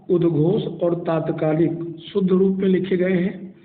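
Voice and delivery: ordinary delivery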